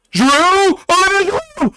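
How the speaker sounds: excited yell cracking up high. Real, not acted. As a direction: voice breaks upward on the shout. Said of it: "My voice just cracked terribly".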